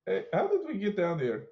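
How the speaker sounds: ordinary delivery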